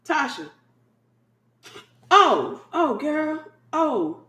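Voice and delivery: high, drawn-out calls; mock-calling to someone who's daydreaming; calling out to a distracted friend. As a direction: pull their attention back, teasing. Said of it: "you like".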